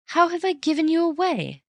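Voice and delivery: high, startled and indignant; as if wrongly accused; indignant surprise. A narrator's reading. Bunny protesting to Raffles, high and startled.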